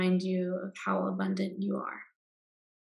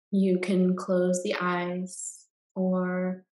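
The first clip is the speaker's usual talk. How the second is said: guided meditation. Acted. Guide leading listeners into Yoga Nidra rest, calm meditation voice.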